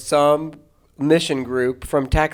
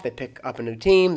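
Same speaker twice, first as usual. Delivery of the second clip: ordinary delivery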